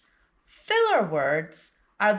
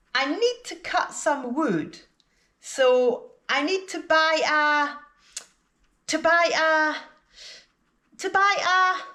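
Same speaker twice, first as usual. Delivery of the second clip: high, "to buy a" repeated with pauses, left hanging; searching repetition; 7 semitones higher than usual. Shown as a demonstration. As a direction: repeat "to buy a" as if hunting for the word. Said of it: "you repeat the last few words before the word you don't know".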